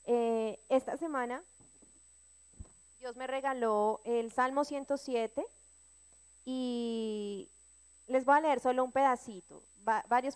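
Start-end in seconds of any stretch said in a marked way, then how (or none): none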